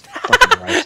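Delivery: very high, scowling cab driver; scowling Oni voice. Acted. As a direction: snarl it out like a grumpy demon.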